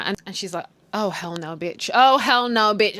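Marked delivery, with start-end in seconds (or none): 1.0-1.9 low, soft disbelief; restrained, simmering acted refusal; low indignation. Acted indignant reaction, first take. 1.9-3.0 loud, outraged acted refusal; hammed-up sassy outrage; loud indignation. Same reaction, repeated louder.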